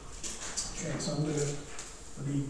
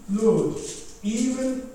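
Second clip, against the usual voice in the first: ordinary talk, nothing marked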